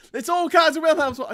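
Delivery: high, loud blaming cry; mock outrage. Host mimicking someone blaming the wrong war, high and loud. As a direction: exclaim it high and loud like an absurd accusation. Acts out absurd blame.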